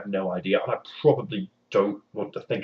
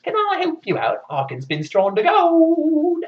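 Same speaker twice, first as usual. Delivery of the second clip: much higher, slow sing-song imp voice; higher wheedling trickster voice; creepy little man; 8 semitones higher, 6 dB louder, slower than usual. Acted. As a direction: coo it like a sly goblin. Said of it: "strange little man shows up".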